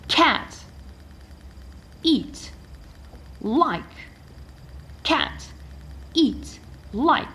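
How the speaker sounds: cat, eat, like each punched high at the start; first-letter stress. Shown as a demonstration. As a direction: hit the first sound of cat, eat, like, one word at a time. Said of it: "we stress the first letter".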